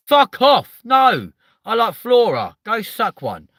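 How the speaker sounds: high, loud angry retort; kid yelling back. Retold or imitated. A playground kid shouting back at the buttercup taunt. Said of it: "playground as a kid".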